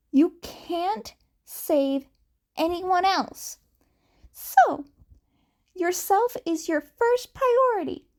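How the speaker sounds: rally him like an earnest friend; eager advice, pitched high; Izuku giving advice. Acted.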